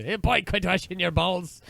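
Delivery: high, loud Scottish outburst; Scottish uncle. Acted. Uncle stunned by the ball of ducks, loud Scottish yell. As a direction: explode in a hammy Scottish accent. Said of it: "Now he's half Scottish".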